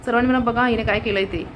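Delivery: ordinary delivery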